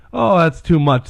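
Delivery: snapped. A narrator's reading. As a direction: snap it a little, projecting. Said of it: "snapped Patrick".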